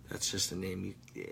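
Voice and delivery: ordinary talk, nothing marked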